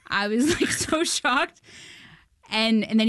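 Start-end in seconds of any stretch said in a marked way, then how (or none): 0.6-1.6 higher, slowed so shocked; drawn-out amazement. Real, not acted. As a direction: draw out the so. Recalling her amazement.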